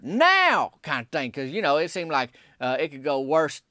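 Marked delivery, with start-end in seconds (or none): 0.0-0.7 high loud single-word shout; hollered. Retold or imitated. Narrator hollers at woman to stop the car, sharp loud shout. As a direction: snap it out like a final warning. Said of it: "Then I just hollered".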